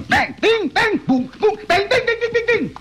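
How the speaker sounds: high, rapid bang bang gunfire sounds; gunshot noises. Retold or imitated. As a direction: fire off the bangs like a pistol. [name] acts out the gunfire.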